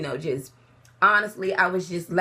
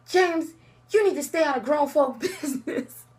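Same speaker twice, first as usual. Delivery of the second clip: sassy scolding; 8 semitones higher, 4 dB louder than usual. Retold or imitated. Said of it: "And I'm all like".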